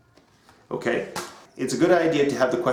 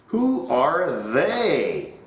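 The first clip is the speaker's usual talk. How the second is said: slow drilled question; 4 semitones higher, much slower than usual. Shown as a demonstration. Drilling the class.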